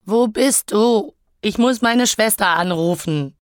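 loud, high, drawn-out plaintive call; sobbing cry. Acted. Elektra searching the flat for her phone, sobbing cry. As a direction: cry it out in desperation. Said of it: "schluchzte ich".

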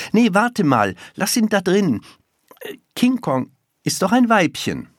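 hint of an alarmed boy; schoolboy objecting. A narrator's reading. Boy's held dialogue voice.

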